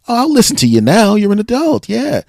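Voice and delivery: high-pitched mock approval; high put-on spectator voice; imitated audience member. Mimics audience warming to older comic.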